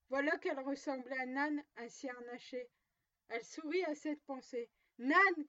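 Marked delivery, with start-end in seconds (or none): 5.0-5.5 loud, higher-pitched call to the donkey. A narrator's reading. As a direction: cry out the name sharply. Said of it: "cria-t-elle".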